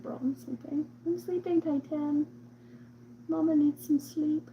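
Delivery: high-pitched, imitated complaint; whiny pleading. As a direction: act out begging the cat to let you sleep. Acts out pleading with cat at night.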